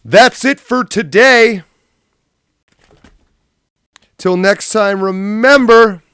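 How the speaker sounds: very high, loud and slow outro voice; shrill cartoon presenter, drawn out; squeaky falsetto host. Shown as a demonstration. Streamer rehearsing his outro in falsetto. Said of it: "outro in a new voice".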